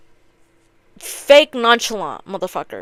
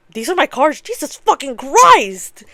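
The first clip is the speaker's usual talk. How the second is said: acted shock; 5 semitones higher, 3 dB louder than usual. Card player seeing his awful hand, mock shock. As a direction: stretch the curse in exaggerated horror.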